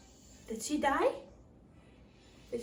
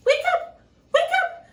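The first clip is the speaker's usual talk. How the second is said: very high, loud call; 12 dB louder than usual. Acted. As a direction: shout to wake her up. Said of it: "says the friendly dwarf".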